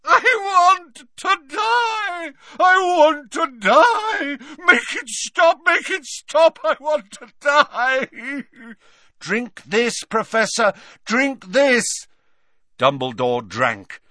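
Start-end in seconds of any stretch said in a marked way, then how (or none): 0.0-8.4 very high, loud, sobbing scream; slow tortured wail at full cry; Dumbledore screaming in anguish. A narrator's reading. Dumbledore begging to die, anguished scream. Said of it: "began to scream in more anguish than ever". 9.2-12.1 desperate urging. A narrator's reading. Harry urging over the screams.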